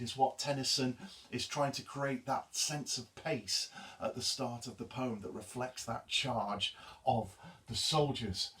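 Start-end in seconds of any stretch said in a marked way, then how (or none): none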